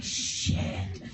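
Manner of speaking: breathy, scared whisper; whispered curse. Retold or imitated. Driver whispering a curse as the cop walks up. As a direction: hiss it under your breath.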